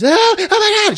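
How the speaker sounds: very high mock shriek; hammed-up horrified cry; panic. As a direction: flip out in a squealing voice. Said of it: "in her panic".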